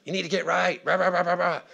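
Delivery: hammed-up shout trailing into rah-rah noise; mock shouting. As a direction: imitate a church yelling at the world. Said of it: "just shouting across to the world".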